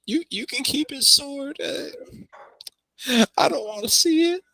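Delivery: tearful. Acted. NPC grieving over sword.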